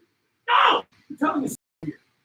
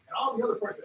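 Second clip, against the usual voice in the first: ordinary talk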